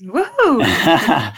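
playful whoop, pitch swooping up and down; excited cheer. Real, not acted. Guest cheering her own introduction on the podcast, excited whoop. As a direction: let out a happy woohoo.

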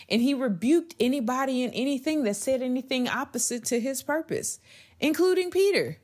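preaching. Acted. Podcast host preaching to listeners about purpose, fervent. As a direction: build it like a pulpit sermon.

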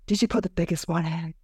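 lightly voiced actress in a dubbed scene; acted porn actress. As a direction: play the actress's question, understated. Acts out dubbed porn actress's line.

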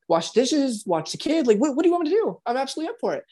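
absolutely up for anything; mock eager helper; eager sincere. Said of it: "you're sincere".

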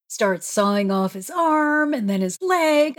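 normal talking voice

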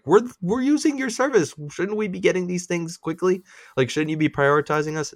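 high, whiny complaint; exasperated whining. Retold or imitated. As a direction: whine it like a scorned customer. Mock pleading to Google.

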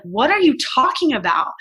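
incredulous. Retold or imitated. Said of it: "And I was like".

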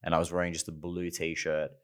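ordinary delivery

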